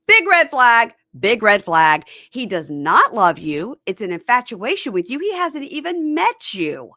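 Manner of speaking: loud imitated tirade, hammering each warning; mom throwing bombs. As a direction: lay into her as an outraged mom. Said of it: "She is just throwing bombs".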